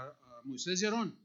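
ordinary delivery

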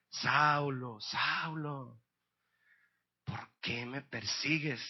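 slow, low, drawn-out divine call; voice from heaven. Acted. Jesus calls to Saul on the road, slow solemn voice. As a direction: call his name slowly and solemnly. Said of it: "oyó una voz que le decía".

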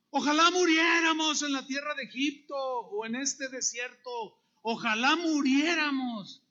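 loud, high mock wail of protest; complaining crowd's cry. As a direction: cry out like a despairing multitude. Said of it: "lloraron y se quejaron contra Moisés".

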